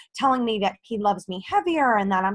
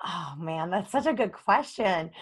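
normal talking voice

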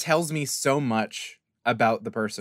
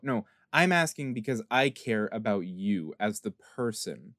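ordinary talk, nothing marked

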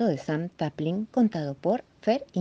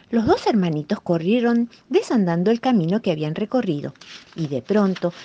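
usual speaking voice

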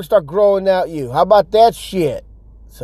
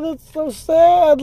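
drawn-out pity; 10 semitones higher, much slower than usual. Real, not acted. Pity for friend.

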